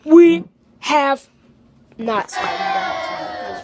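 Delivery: loud slow shout. Real, not acted. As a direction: blurt it out loudly. Sudden outburst to viewers.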